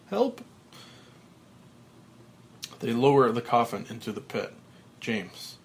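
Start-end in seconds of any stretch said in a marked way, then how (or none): 0.1-0.4 high yelp for help; cry for help. Acted. Frank in the lowered coffin, high yelp. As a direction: scream out for rescue.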